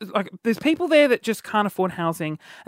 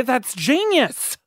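normal talking voice

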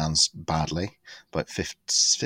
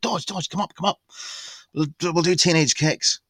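calling out; 9 semitones higher than usual. Retold or imitated. Calling friend from audience.

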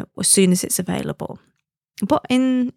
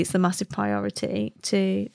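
ordinary delivery

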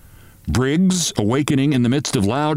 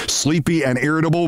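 plain reading voice

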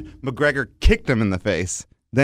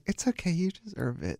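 much softer, higher consoling voice; gently reassure, hushed and high; soothing wife; 4 semitones higher, 12 dB quieter than usual. Retold or imitated. Imitating his wife consoling him over the money, soothing. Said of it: "my wife's like".

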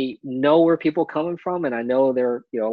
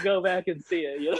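usual speaking voice

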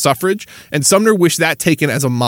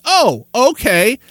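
sarcastic okay; 7 semitones higher, much slower than usual. Real, not acted. Reacting to condescending quote.

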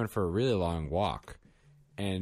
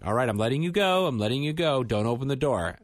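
hurried raised voice; 7 semitones higher, 6 dB louder than usual. Retold or imitated. Letting screaming child go.

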